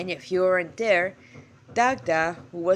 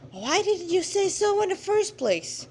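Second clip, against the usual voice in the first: put-on much higher girl's voice, relieved; 11 semitones higher than usual. Girl relieved at the god's respect, in a high voice. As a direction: ask it brightly, relieved.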